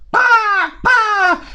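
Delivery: high loud bah squawks; frustrated crow squawk. Acted.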